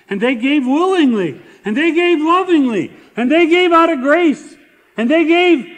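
high, loud, rising preacher's chant; preaching at full force. Acted. Pastor exalting the poor givers of Macedonia, loud preaching chant.

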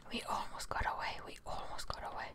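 ordinary talk

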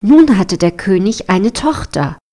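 ordinary delivery

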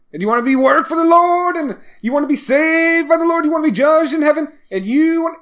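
high, fast preacher's chant, like an auctioneer; faith healer voice. Retold or imitated. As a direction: rattle it off like a frantic revival preacher.

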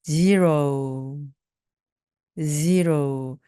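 ZERO drawn out, ending in an "ou" glide. Shown as a demonstration. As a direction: keep the "oh-oo" at the end of ZERO, falling. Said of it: "그걸 살려주시면 됩니다". (zero 끝을 '오우'로 살려서)

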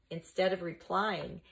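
usual speaking voice

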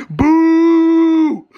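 big, high, loud boo. Real, not acted. Fan booing the rival's free throw, loud. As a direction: boo the shooter at full voice.

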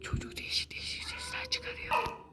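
whispering. Real, not acted. Whispering aside to viewers.

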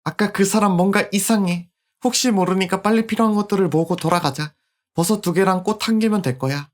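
a hint of a young girl; polite little girl voice; lightly voiced little girl.

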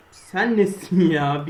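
loud, slow Sen nesin ya; angry slow yell. Real, not acted. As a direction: shout it slowly at the mob. Raging at mob. (yavaş öfkeli bağırış)

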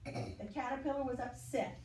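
usual speaking voice

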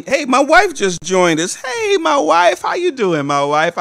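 high, loud call to his wife; light up and call out to her; delighted hello across the room; excited greeting. Real, not acted. Greeting wife who joined.

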